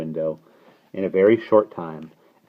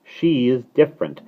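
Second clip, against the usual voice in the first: higher, emphatic declarations; each verdict pitched up and louder; declaiming; 7 semitones higher, 9 dB louder than usual. A narrator's reading. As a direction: build it line by line. Starling's derision in dialogue.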